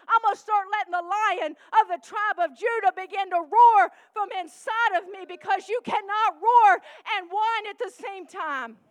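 loud shouted climax; shouting praise from the pulpit; roaring preaching. Real, not acted. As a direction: let it roar like a lion.

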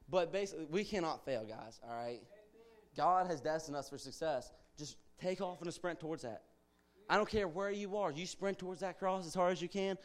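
ordinary talk

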